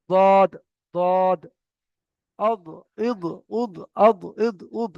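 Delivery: দদ, অদ, ইদ, উদ with a heavy d from the tongue's side on the upper molars; articulation drill. Shown as a demonstration. Teacher models letter seven, tongue-side d in দদ, অদ, ইদ, উদ. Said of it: "এমন ভাবে উচ্চারণ করতে হবে".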